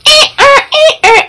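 high squeaky rubbing noise, eh ah; balloon squeak imitation. Sister rubbing a balloon, imitated squeaky noise. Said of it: "she's like".

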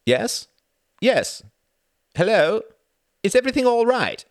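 man raising his voice on a bad telephone line; loud on a bad line. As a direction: shout into the crackling phone. Straining on a bad phone line.

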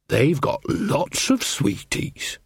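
slow, hushed sly coaxing; coaxing whisper. A narrator's reading. Nanny coaxing the child away.

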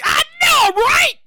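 high, emphatic agreement; excited agreement. Acted. Host agreeing with an imagined listener, excited.

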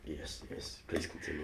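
unvoiced, very soft encouragement; whispered. Real, not acted. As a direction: whisper to keep him talking. Hushed encouragement.

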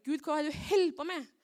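loud, high, desperate shouting; furious cry to God; angry yelling at God. Retold or imitated. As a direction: rage at God, voice straining high. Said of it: "Og jeg ble så sint".

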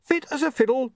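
high panicky wail. A narrator's reading. William fends off Nobbs's first aid, high desperate wail. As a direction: wail it out to ward off help. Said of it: "William wailed".

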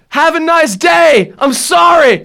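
loud, high, slowed mock-polite shout; sarcastic farewell. Driver rolling down window to shout a fake-sweet goodbye. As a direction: sign off with exaggerated politeness.

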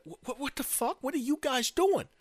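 high, stammered protest; indignant disbelief. Acted. New president being patted down by guards, high indignant protest. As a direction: protest in high-pitched bewilderment.

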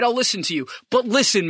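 ordinary delivery

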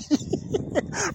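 a quick breathy laugh at his own joke; short laugh. Real, not acted. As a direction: laugh it off for a second. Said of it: "I'm laughing".

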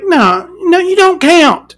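dismissive and exasperated. Real, not acted. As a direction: scoff it off, pitch up. Dismissive rant at Meghan.

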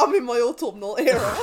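teasing quote through laughter; laughing mock quote. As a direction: say it while laughing. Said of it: "You were like".

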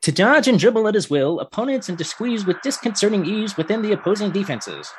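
high, brisk newsreel announcer; old-timey radio voice. Shown as a demonstration. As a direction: read it like a 1930s radio man. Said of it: "I'm gonna do this in an old-timey radio voice".

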